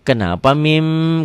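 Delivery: ordinary delivery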